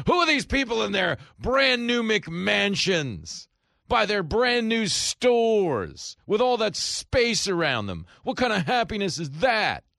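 high, loud, drawn-out incredulous wail; theatrical shouted disbelief; mock snob outrage. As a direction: ham up a scandalized city elitist. Acts out snobbish New Yorkers' outrage.

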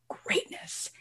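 breathy whisper of a single word. Real, not acted. Host whispers the word to listeners. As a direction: whisper it like a secret.

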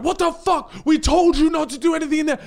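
high, loud furious outburst; outraged shout. Retold or imitated. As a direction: yell it in shocked outrage. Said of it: "And then everyone's like".

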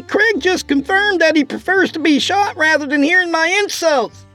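very high, sardonic called-out speech; shouting to the cabin. A narrator's reading. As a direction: call it out high toward the cabin. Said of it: "Lorna shouted in the cabin's direction".

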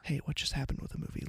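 quick, hushed whisper. Acted. Moviegoer asking a friend what happened, whispering. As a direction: whisper it to your seatmate. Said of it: "You can whisper".

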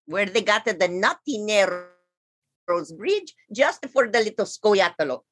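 lightly put-on Italian accent. Squirrel Coney explains where he lives, light Italian accent. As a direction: tell your squirrel story with a light Italian lilt. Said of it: "is it actually your real accent or are you mocking us".

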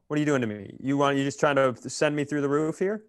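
usual speaking voice

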